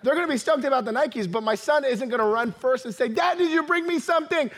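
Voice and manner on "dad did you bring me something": higher-pitched imitation of an eager kid; eager child. Imagined son running to greet his dad, eager. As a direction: play a kid pestering dad.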